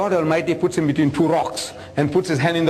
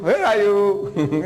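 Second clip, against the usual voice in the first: slow, stretched-out teasing "Where are you?"; drawn out; much slower than usual. Retold or imitated. Grandpa teases his grandson, slow stretched mock search. Playing hide and seek with grandson.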